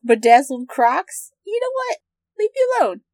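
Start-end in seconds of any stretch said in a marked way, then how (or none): 1.4-3.0 high, huffy mock defensiveness. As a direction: snap back in mock offense. Mock defensive about Crocs.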